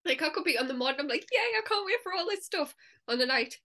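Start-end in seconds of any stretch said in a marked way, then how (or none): 1.3-2.8 high-pitched mock cheer; excited. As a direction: act out morning eagerness in a high, bubbly voice. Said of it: "it just switches up so badly".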